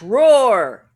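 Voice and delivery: growled roar call; big playful roar. Pretend.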